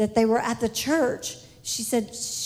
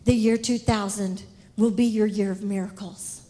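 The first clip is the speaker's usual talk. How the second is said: voice of the Lord; 5 semitones lower than usual. Retold or imitated. The Lord promising Suzanne a year of miracles, solemn. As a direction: speak as the Lord, grave and sure. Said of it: "the Lord said".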